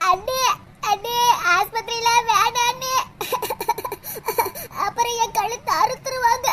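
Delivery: low, slow, whimpering plea from a sore throat; pained girl with bone in throat. Acted. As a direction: beg weakly, voice low and hurting. Fish bone stuck, pained girl. (தொண்டை வலியில் தாழ்ந்த குரலில் கெஞ்சும் பெண்)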